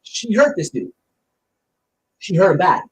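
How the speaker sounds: usual speaking voice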